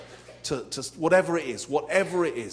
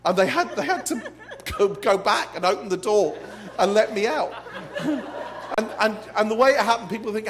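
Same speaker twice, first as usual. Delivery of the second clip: much higher and louder through laughter; laughing while telling; 7 semitones higher, 6 dB louder than usual. Real, not acted. Preacher recounting his plane mix-up, laughing hard. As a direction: barely hold the story together for laughing.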